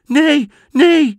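very high, loud scared squeal; trembling cry. Acted. Goat kids seeing the brown paw, terrified high shriek. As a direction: shriek it in terror. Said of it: "riepen ze bibberend".

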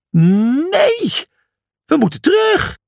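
high, whining mock wail; a hammed-up whine of despair. A narrator's reading. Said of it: "jankte Brutus".

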